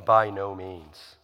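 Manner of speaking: slow, measured refusal; emphatic. Retold or imitated. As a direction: state it slowly and firmly, understated. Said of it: "emphatically says".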